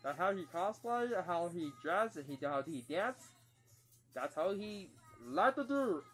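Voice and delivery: booming little Martian announcing itself; Marvin the Martian voice. Acted. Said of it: "Duck Dodgers as Marvin the Martian".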